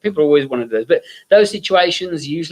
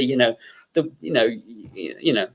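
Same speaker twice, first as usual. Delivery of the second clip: usual speaking voice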